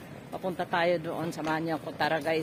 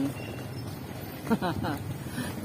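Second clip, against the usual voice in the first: soft chuckle; 5 dB quieter than usual. Real, not acted. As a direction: chuckle lightly to yourself. Laughs at own remark.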